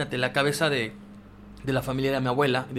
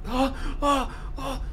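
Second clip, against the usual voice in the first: high-pitched retold scream of a sleepwalking child; 13 semitones higher, slower than usual. Boy screaming in his sleep at the beach house, high retold cries. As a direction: cry out high, imitating the night screams. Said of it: "empecé a gritar así".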